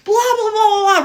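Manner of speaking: high, loud run of nonsense blahs; mock blabbering. Storyteller mimicking gibberish while walking towards him, mock blabbering. Imitates gibberish at the approaching man.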